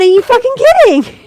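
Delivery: loud, high-pitched disbelieving outburst; incredulous yell. Retold or imitated. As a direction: blurt it out in outraged disbelief. Acted outburst of disbelief in anecdote.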